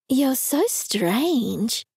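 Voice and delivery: slow, drawn-out deadpan; deadpan remark. Acted. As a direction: say it slowly, unimpressed. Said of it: "Janet said".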